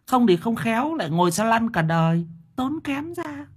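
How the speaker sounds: put-on high-pitched fussy old wife rambling; chattering wife. A narrator's reading. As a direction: prattle on, tactless and fussing. (bà vợ lắm mồm nói giọng cao)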